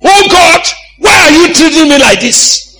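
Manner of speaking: wailing at God for all to hear; loud public complaint. Retold or imitated. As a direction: cry out to God at full volume in public. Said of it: "somebody will say, Ah, what's wrong with you".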